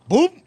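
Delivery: a high, popped-out boop; boop sound effect. Retold or imitated. Mocking the hot dog falling, a high boop. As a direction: squeak out the drop of the hot dog.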